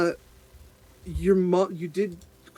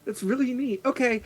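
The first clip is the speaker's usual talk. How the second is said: put-on high, excited childlike gush; thrilled little girl; 11 semitones higher, 4 dB louder than usual. Sea elf child thrilled Acacia is a druid, high and gushing. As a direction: gush like a kid who found a friend.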